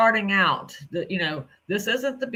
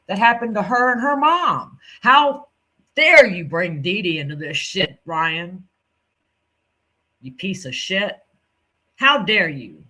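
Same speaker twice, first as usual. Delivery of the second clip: furious outburst; 2 semitones higher, 5 dB louder than usual. Real, not acted. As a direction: let it explode, how dare you. Furious at Ryan's explanation.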